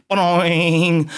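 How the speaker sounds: drawn-out ringing boing; imitated bonk. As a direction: stretch it like a cartoon spring. Imitates head bonking on bench.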